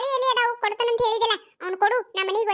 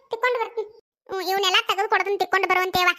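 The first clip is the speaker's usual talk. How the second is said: ordinary talk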